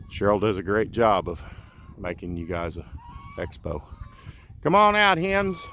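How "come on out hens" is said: high, loud call to the hens; calling chickens. Real, not acted. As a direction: call out to the flock.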